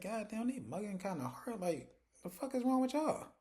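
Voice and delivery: high-pitched, affronted disbelief; mock incredulity. Couple reacts to being mean-mugged.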